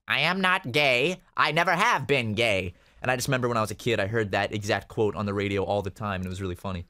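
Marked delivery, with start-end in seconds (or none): 0.1-2.7 slow, emphatic mock denial; deliberate, word-by-word mock protest; imitating press-conference denial. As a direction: lightly imitate a solemn public statement. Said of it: "he had to go to a press conference where he's like".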